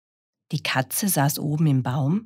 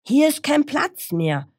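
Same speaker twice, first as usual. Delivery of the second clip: high, raised protest; calling out; 11 semitones higher, 4 dB louder than usual. Acted. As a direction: animals shouting a newcomer away. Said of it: "riefen die Tiere".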